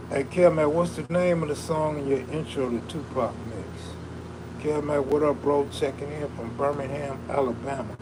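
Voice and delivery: ordinary talk, nothing marked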